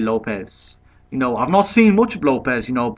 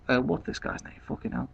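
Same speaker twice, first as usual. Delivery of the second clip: muttered aside; 11 dB quieter than usual. Real, not acted. Muttered aside while forgetting name.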